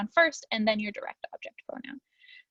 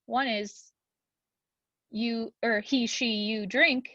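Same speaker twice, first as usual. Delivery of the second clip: usual speaking voice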